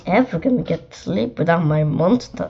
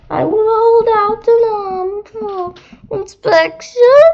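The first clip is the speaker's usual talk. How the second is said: monster Ralph; 14 semitones higher, 6 dB louder than usual. Acted. Monster greeting a child in a high, prim voice. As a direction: play a well-groomed monster introducing himself. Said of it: "Ralph replied".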